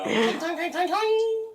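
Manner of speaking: high-pitched vocal clatter, climbing upward; loud, squeaky clang imitation through laughter; imitated clanging silverware. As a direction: mimic cutlery hitting the floor. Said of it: "silverware on the floor".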